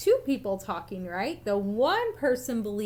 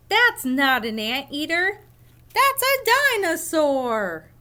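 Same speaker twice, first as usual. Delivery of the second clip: louder, higher, slow correction; retorting contradiction; 6 semitones higher, 6 dB louder than usual. Acted. Other reader contradicts the first, loud and emphatic.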